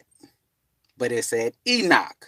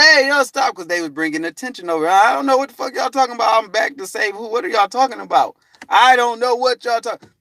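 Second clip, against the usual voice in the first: high, loud and fast flustered denial; flustered protest; 10 semitones higher, 4 dB louder than usual. Retold or imitated. Man telling people to stop drawing attention, flustered. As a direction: wave them off, baffled.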